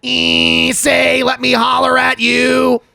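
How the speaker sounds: slow drawn-out catcall shout. Pretend. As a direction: holler it at a passing girl. Bugs catcalling like boys.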